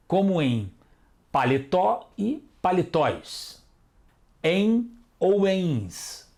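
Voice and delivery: terminações bem pausadas. Shown as a demonstration. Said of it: "São acentuadas as oxítonas terminadas em".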